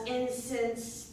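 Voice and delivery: ordinary talk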